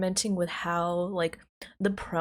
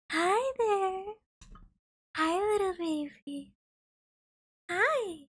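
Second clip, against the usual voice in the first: high, drawn-out cooing to a kitty; sweet high pet-talk; cat voice; 8 semitones higher, slower than usual. Real, not acted. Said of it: "it's my cat voice".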